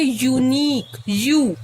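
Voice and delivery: "यूनिक" opening on a clear yoo, then "यू" alone; YOU sound. Shown as a demonstration. Teacher showing which sound starts the word, clear yoo in "यूनिक". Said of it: "आवाज किसकी आ रही है".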